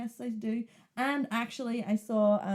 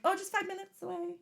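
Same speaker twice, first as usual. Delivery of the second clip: breezy reply; 10 semitones higher, 4 dB quieter than usual. Retold or imitated. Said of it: "So I'm like".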